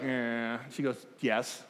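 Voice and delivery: low, soft reluctant answer; grudging, barely audible reply. Retold or imitated. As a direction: guest reluctantly admits she is married. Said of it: "kinda looking at me like".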